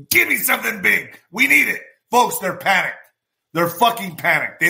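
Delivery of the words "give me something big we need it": mock pleading. Voicing panicked opponents.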